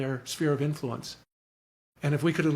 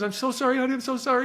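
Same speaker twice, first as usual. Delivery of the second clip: high-pitched, imitated tearful apology; breaking down; 9 semitones higher, faster than usual. Mother hugging her daughter and apologizing, imitated breakdown. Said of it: "she breaks down".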